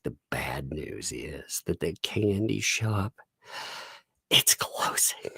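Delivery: slow, hushed, grave delivery; imitating a solemn, upset kid; upset child. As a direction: break tragic news very softly. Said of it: "She was so upset".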